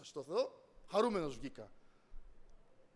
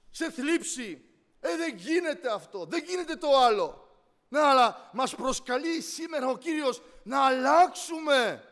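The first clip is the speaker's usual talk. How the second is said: raised, insistent sermon voice; impassioned preaching; 8 semitones higher, 12 dB louder, slower than usual. Real, not acted. Preacher calling the church to change its thinking, impassioned. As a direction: press the appeal home, drawing out key words.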